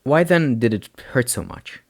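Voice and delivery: ordinary delivery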